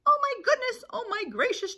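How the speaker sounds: high, loud flustered chick; panting panic. Acted. Chicken Little answers Henny Penny, hammed-up breathless alarm. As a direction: gasp it out in mock panic.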